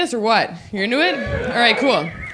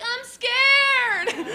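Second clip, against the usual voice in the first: put-on scared kid; 12 semitones higher than usual. Tiffany scared of sour candy.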